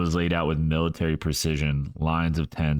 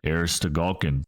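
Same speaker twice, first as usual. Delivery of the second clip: ordinary delivery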